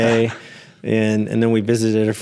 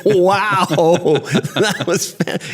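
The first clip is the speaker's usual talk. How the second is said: normal talking voice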